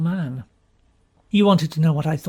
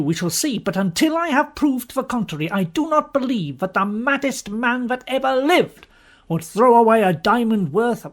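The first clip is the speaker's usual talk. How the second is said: plain reading voice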